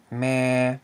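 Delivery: meh said on a level mid tone; Thai mid tone. Shown as a demonstration. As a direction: say meh evenly on a mid pitch. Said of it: "this word is pronounced as".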